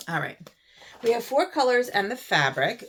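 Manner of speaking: gurgly voice, throat needs clearing. Real, not acted. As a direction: talk through a gurgly throat. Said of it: "I'm all gurgly today in my throat".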